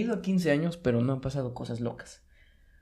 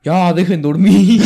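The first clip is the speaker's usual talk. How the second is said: put-on old neighbor yelling; 4 semitones higher, 15 dB louder, much slower than usual. Neighbor yelling at noisy kids at night: slow loud shout. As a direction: boom it out like a fed-up neighbor at midnight. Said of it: "entonces nada más que un señor dice".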